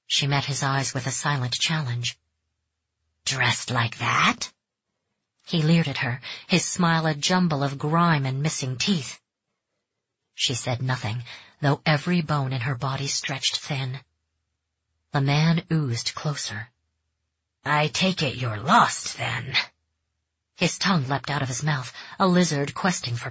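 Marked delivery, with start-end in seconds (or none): 3.2-4.5 leering man. Acted. Stranger leers at her. 17.6-19.5 creepy man. Acted. Stranger oozes closer.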